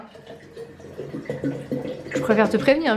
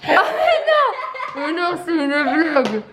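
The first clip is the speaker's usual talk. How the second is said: laughing. Real, not acted. Laughing disbelief at water bulge.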